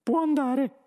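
high, squeaky dismissal; brusque mouse. A narrator's reading. Mouse boss dismisses the old man, brusque squeak. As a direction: dismiss him curtly as a mouse. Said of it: "il topo Benji bruscamente".

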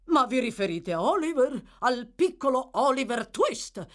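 più alto e più forte, stupito; esclamazione sorpresa. Acted. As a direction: esclama incredulo riconoscendo il nome. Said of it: "esclamò il signor Bumble". (Bumble esclama sorpreso, voce alta)

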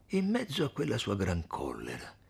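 plain reading voice

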